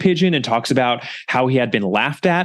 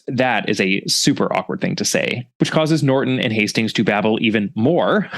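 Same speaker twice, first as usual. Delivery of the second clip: ordinary talk, nothing marked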